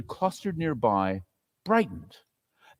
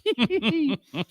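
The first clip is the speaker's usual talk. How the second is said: wicked cackle; 12 semitones higher than usual. Acted. Thief's closing cackle.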